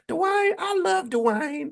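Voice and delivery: high and slow, drawn-out southern-belle swoon; Whitley Gilbert impression. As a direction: swoon over Dwayne like Whitley. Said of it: "remember how she talked".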